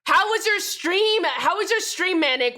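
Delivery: high, loud, thrilled greeting; excited raid welcome. Real, not acted. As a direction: yell your thanks for the raid. Surprise raid arrives.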